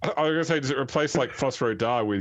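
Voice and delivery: ordinary delivery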